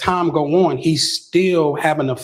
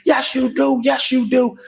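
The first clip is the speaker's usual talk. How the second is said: pitched-up, pleading mock sob; retelling a friend's tearful plea in a higher voice; imitating a tearful insistence; 8 semitones higher than usual. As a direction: copy a crying man insisting, voice raised high. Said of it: "So he crying".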